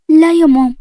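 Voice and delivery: alarmed refusal. A narrator's reading. Malak stops her mother from telling Omar, alarmed. Daughter refuses to tell fiancé.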